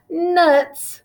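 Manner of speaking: a light, grumpy little "nuts"; annoyed thought. A narrator's reading. Noelle grumbling to herself that it's Christmas again, mildly annoyed. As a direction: huff it to yourself, mildly fed up. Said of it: "thought Noelle".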